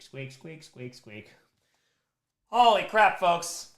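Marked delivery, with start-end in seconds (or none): 2.5-3.7 louder and higher burst of amazement; excited exclamation. Real, not acted. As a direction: blurt it out, amazed. Amazed by the total.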